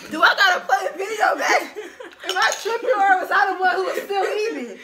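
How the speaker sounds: loud, high, laughing indignation; laughing protest. Real, not acted.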